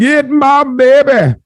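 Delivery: very high, drawn-out comic shout; cartoonish mock holler; Fat Bastard. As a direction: stretch out a high hammed-up yell. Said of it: "Why he sound like Fat Bastard?".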